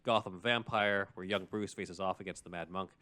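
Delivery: ordinary talk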